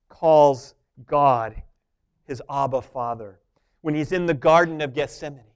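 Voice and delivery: ordinary delivery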